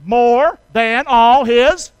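very slow and loud, word by word; booming, spaced-out prompt to join in; say-along. Shown as a demonstration. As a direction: lead the congregation firmly through it. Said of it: "say it with me".

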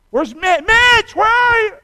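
high, loud shout for Mitch; father shouting for his missing son in the dark; calling out. Retold or imitated. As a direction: call into the dark for a lost son.

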